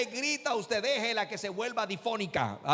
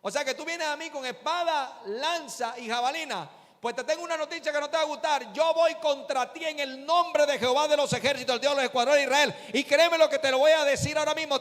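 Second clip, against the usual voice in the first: David defying Goliath; 5 semitones higher, 4 dB louder than usual. Acted. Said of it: "y le dice".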